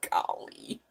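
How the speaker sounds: breathless whispered "Golly". Real, not acted. A whispered aside to herself after laughing.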